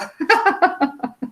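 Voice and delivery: light, high-pitched laugh; laughter. Real, not acted. Student laughing to examiner before an oral presentation, high laugh.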